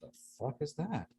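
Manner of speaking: acted quiet bewilderment; hushed disbelief. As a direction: mutter it under your breath, puzzled. Startled listener hearing a voice.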